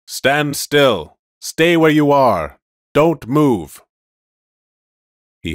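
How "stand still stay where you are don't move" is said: loud barked police orders; shouted commands. Acted. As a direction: shout them to freeze. Police car commands from loudspeaker.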